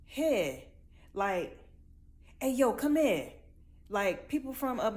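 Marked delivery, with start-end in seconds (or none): 0.1-3.3 louder, northern Hey, yo, come here. Retold or imitated. Speaker imitating the stranger's northern accent, louder. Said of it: "I just heard you say".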